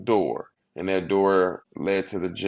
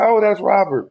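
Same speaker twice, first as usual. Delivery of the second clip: high, louder bright introduction; cheerful hostess; 11 semitones higher, 6 dB louder than usual. Retold or imitated. Brenda introduces Robert to her sons, high and cheery. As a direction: sing out the name to reassure the sons. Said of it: "She said".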